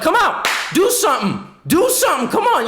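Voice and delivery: high-pitched mock fight challenge; mock street-fight callout; taunting challenge.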